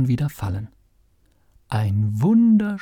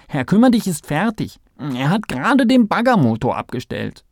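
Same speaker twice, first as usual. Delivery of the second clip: hoch und laut drängend; aufgeregt; 6 semitones higher, 5 dB louder than usual. A narrator's reading. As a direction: Theo aufgeregt zum Aufstehen antreiben. Kalle drängt den verschlafenen Theo. (aufgeregter Maulwurf)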